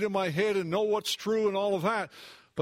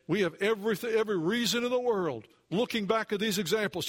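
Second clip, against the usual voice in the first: usual speaking voice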